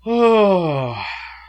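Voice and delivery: long, drawn-out sigh; big deliberating exhale; reluctant sigh. Real, not acted. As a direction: heave a sigh before a tough choice. Asked to pick a dislike.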